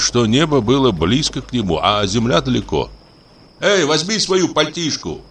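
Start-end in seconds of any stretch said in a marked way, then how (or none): 3.6-5.2 high-pitched mild shout; calling up from below. A narrator's reading. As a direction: call out to someone up above. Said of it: "крикнул где-то далеко внизу Дениска". (окрик издалека снизу)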